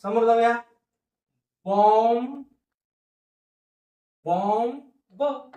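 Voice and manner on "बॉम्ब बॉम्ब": Bomb stretched very slowly; teacher stretching the word bomb; slow sounding out. Shown as a demonstration. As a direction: draw bomb out long. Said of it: "संपला शब्द".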